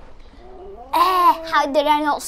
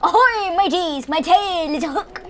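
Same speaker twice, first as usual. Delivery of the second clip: high-pitched, hearty pirate call; playful pirate; 5 semitones higher than usual. Pretend. Player voicing the new pirate costume, playful pirate. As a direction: hail the crew like a cartoon pirate. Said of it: "he's a pirate".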